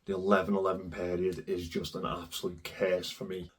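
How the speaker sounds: low, soft, hoarse sick voice; hoarse from a cold. Real, not acted. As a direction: rasp like a heavy smoker with flu. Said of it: "I sound like I've smoked sixty cigarettes".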